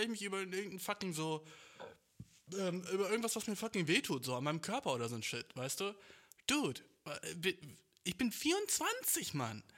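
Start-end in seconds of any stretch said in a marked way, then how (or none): 6.5-9.3 high, slow, exasperated stretch; indignant complaint. Real, not acted. Young man complaining of a failing body, indignant. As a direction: protest your age in a high, drawn-out voice.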